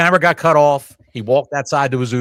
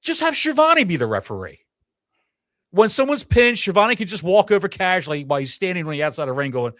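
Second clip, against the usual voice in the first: normal talking voice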